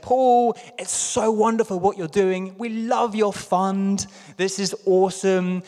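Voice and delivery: high, eager, gushing voice; gushing praise. Retold or imitated. Corinthians gushing to Paul, high eager praise. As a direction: gush with delight at Paul's fund.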